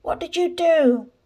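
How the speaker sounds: high, loud demand; shouting. A narrator's reading. Very young man grabbing the boy by the hair, shouting. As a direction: shout it as an accusing question.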